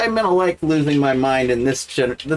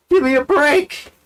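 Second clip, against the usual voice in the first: exasperated; 9 semitones higher, 3 dB louder than usual. Real, not acted. Exasperated repeat, loud.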